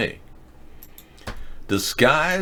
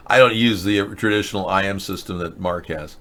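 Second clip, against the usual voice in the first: ordinary talk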